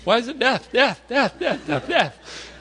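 high-pitched fretful kid, imitated; anxious child questioning. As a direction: pester worriedly, fixating on the word. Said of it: "he starts asking all these questions".

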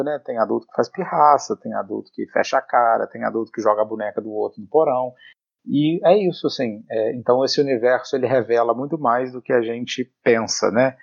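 ordinary talk, nothing marked

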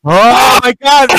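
stretched, high shocked gasp. Real, not acted. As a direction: recoil in horror at the photo. Reacting to the horrifying photo.

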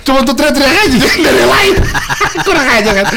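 high-pitched, laughing through the words; sambil tertawa. Real, not acted. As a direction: tell it while cracking up. Menertawakan tugas seksi keamanan. (bercerita sambil tertawa geli)

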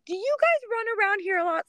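high tipsy chatty lady; drunk neighbor. Retold or imitated. Drunk neighbor chatting up the runners, high-pitched. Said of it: "And lady's like".

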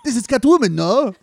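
high, loud hammed-up French with a laugh; high giddy French director laughing through the pitch; mock French accent. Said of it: "this accent".

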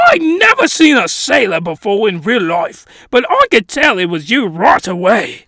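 gushing, badly faked British voice; hammed-up British accent; terrible British accent. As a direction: do a bad British fanboy. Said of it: "terrible British accent".

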